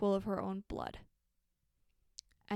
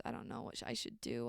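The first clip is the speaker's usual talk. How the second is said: near-whispered, trailing self-doubt; muttering under his breath, unsure; hushed hesitation; 10 semitones lower, 11 dB quieter, faster than usual. Retold or imitated. Brother hesitating outside his sister's door, hushed.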